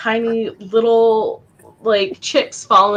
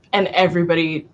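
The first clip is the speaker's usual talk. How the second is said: ordinary talk, nothing marked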